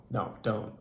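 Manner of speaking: ordinary delivery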